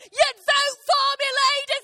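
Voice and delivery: slow, high campaign yell; hammed-up rally cry, very loud; rallying shout. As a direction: rouse the crowd to vote. Acts out rallying the cheering ladies.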